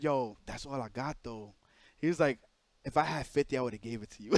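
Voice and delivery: lightly voiced hush, as if nobody should hear; secretive. Charles apologizing for the small payment, a soft hush. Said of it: "doing it like it was on some secret shit".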